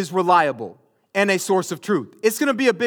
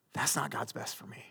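whispered regret; 6 semitones lower, 15 dB quieter than usual. Retold or imitated. Imagined spouse's regret.

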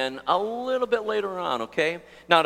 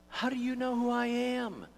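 high, soft puzzled question; baffled reply; 7 semitones higher, 6 dB quieter than usual. Retold or imitated. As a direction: act out your surprise at being recognised. Acted reply to the couple.

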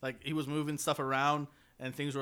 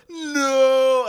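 long, high, loud mock wail; Darth Vader; 10 semitones higher, 11 dB louder than usual. Host retelling the moment that ruined it, hammed-up loud wail. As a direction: imitate an over-the-top anguished villain scream. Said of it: "the worst no in, in cinema history".

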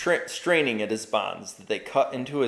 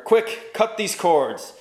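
high, loud, then slow command; urgent cry; 8 semitones higher, 5 dB louder, slower than usual. Acted. Bound knight commanding the children, loud and urgent. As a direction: bark the order to cut him loose. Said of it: "he said loud and clear".

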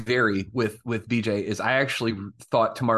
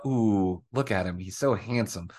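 low, softer swooning; swooning murmur; 5 semitones lower, 3 dB quieter than usual. Retold or imitated. La'an swooning over Kirk: low, soft murmur. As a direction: sigh over a handsome man.

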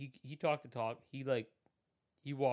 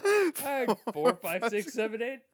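high, loud, laughing count; giggling mock count; 10 semitones higher, 9 dB louder than usual. Real, not acted. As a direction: laugh through the numbers. Mocking rating list, laughing.

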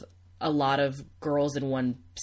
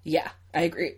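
choking on saliva; 3 semitones higher, 6 dB louder than usual. Real, not acted. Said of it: "I'm choking on my own saliva".

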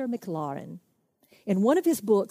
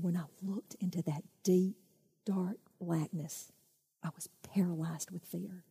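fearful hush; 7 dB quieter than usual. Retold or imitated. Alex at the ravine's edge, whispering in fear. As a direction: let the fear freeze the words.

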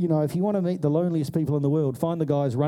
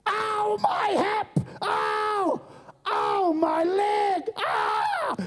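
much higher, crying out in pain as the fallen lady; old lady in pain; much slower than usual. Retold or imitated. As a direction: howl ow my hip like a hurt old woman. Lady falls into the hole.

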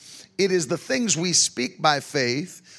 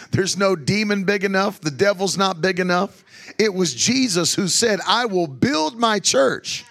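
louder, fervent preaching voice; 2 semitones higher, 4 dB louder than usual. Acted. Preacher rallies the church, loud and fervent. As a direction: drive it home to the congregation.

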